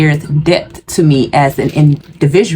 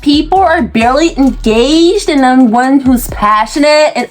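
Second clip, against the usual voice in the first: high, irritated complaining voice; high whiny complaint; 8 semitones higher than usual. Real, not acted. Venting to viewers about boring coworkers, in a high irritated voice. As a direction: gripe about coworkers in a high, exasperated pitch.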